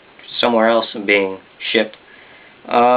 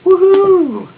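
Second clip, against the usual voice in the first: excited whoop; 6 dB louder than usual. Real, not acted. Excited return to channel.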